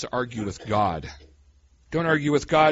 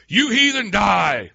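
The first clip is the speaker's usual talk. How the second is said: higher, louder hammed-up scolding; mock furious rebuke; 6 semitones higher, 5 dB louder, slower than usual. Preacher jokingly voicing a mock angry Jesus rebuking Peter. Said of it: "your Bible doesn't say that either".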